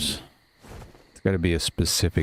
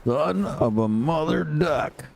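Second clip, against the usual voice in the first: drawn-out, high-pitched mock cursing; hammed-up outburst, slow and high; acted exasperation; 7 semitones higher, much slower than usual. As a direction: stretch out a cleaned-up curse in exasperation. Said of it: "that's what Ark does to me".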